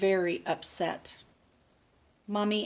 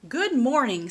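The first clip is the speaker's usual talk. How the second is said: Mister Fox greeting; 5 semitones higher, 8 dB louder than usual. Acted.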